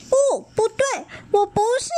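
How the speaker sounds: scared denial. A narrator's reading. Child weakly denying.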